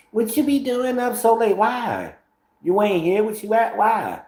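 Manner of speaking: high mocking voice of a nosy girlfriend; nosy woman voice. Mocking a nosy partner who tracks his schedule, high voice. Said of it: "They so nosy".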